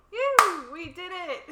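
loud, high whoop of joy; excited cheer. Real, not acted. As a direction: cheer like you just finished. Celebrating end of recording.

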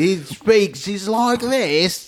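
slow, put-on Cockney drawl; Cockney accent. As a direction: imitate an actor's Cockney accent. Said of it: "a Cockney accent".

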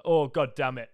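loud exasperated repeat; annoyed groan again. Retold or imitated. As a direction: spit it out fed up.

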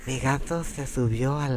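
mock Castilian lisp; lisping Spanish accent. Demonstrating the lisp they have, lisping Spanish accent. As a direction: put on a lisping accent from Spain. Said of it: "So they have lisp".

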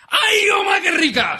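high, shouted mock delight; excited exclamation. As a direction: cry it out in exaggerated delight. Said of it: "¿cómo lo has dicho?".

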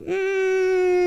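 long, very high imitated hum; Oompa Loompa hum. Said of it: "It's a bunch of Oompa Loompas".

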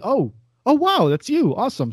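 surprised. Retold or imitated. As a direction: act out a happy recognition. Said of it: "I'm like".

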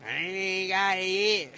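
loud, slow, drawn-out mock boast; exaggerated mocking quote. Mimicking people who think karma missed them, exaggerated mocking. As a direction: stretch it out loudly in an exaggerated mocking voice. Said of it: "them type of people that say".

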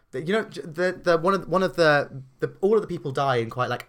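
normal talking voice

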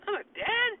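squeaky, sky-high what; shocked. Retold or imitated. Man hearing he has a son, squeaking in shock. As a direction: shoot way up high in disbelief. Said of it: "he's like".

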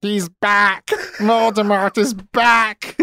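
mock anguished child-actor cry; high, drawn-out melodramatic outburst; Harry Potter. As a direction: overact it like a rough child performance. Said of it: "then it cuts to Harry, and he's like".

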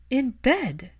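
loud, high clipped reply; curt angry. Acted. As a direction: snap it back.

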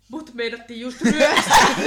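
reading his text out loud through laughter, slowed; loud laughing delivery of the message; laughing quote. Retold or imitated. Friend reading out the text about the robbery attempt, laughing loudly. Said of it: "se laittaa meille".

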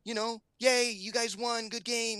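put-on high kid voice taking it in stride; daughter's brave acceptance. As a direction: imitate a daughter shrugging off disappointment. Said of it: "and she's like".

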